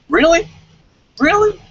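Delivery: high, loud mocking "really?"; sarcastic disbelief. Real, not acted. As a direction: scoff at the claim in a high, loud voice. Sarcastic disbelief at opponent's claim.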